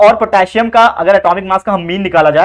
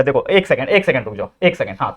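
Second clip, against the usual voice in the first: muttered much softer and lower while fixing a mistake; quiet aside; 7 semitones lower, 6 dB quieter than usual. Real, not acted. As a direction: drop the voice to yourself, one second. (गलती सुधारते हुए धीमी आवाज़ में बुदबुदाना)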